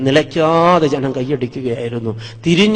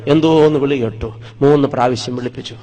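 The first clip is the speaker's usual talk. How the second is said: ordinary delivery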